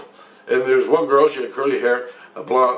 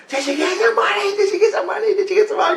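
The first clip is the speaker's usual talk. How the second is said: put-on squeaky high childlike voice, eager; kids' voices; faster than usual. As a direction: imitate excited little kids in a high squeak. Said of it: "The kids, and they're going".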